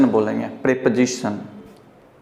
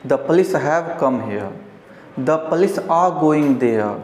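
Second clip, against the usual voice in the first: slow example; much slower than usual. Shown as a demonstration. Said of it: "have not has".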